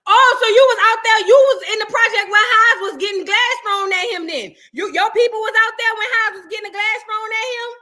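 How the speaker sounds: high, loud laughing questions; can't believe it, laughing; excited disbelief. Real, not acted.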